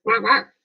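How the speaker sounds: high bright quack quack with a rise; duck quacking. Pretend. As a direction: quack again, rising at the end. Imitating a duck for viewers.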